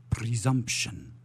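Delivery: slow title read fading to a whisper; spooky radio-play title; dramatic title read. Acted. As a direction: announce the title and let it sink to a spooky whisper.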